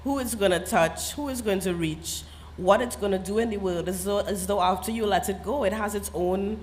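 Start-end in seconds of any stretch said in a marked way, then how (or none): none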